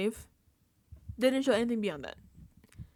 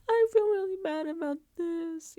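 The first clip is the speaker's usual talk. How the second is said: higher, whiny imitation of a tearful apology; mock crying whine; 8 semitones higher than usual. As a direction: mimic her fake tears, lightly. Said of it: "she's crying".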